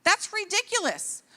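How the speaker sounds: ordinary talk, nothing marked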